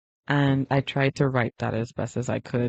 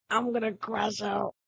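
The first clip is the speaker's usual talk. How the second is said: higher but held in; crashing out; 5 semitones higher, 4 dB quieter than usual. Real, not acted. Streamer waits on the dead connection, starting to crash out. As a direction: lift the pitch as frustration builds.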